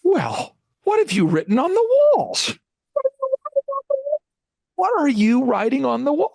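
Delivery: high, shrill-edged mock mom voice, voice cracking; high mocking mother voice; imitating mom. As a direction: squeak it out like a flustered mom. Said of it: "I'm not mocking my mom".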